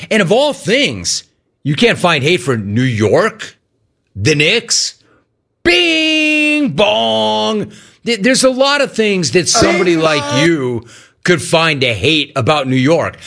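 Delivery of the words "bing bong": high, sustained, two-note "bing bong"; chanted catchphrase. Acted. Host needling Gino about the Knicks, high mock chant. Imitates New York fans' chant.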